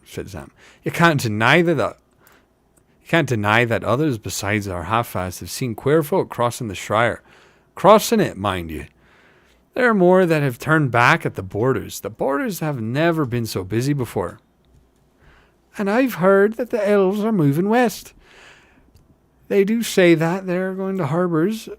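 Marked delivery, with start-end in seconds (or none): 0.8-14.4 rustic hobbit vouching for a tale; lilting village gossip with punched emphasis; insistent working hobbit. Acted. 15.8-21.7 high, louder rustic hobbit; eager village gossip up high; high character voice. Acted. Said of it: "I got the two characters backwards".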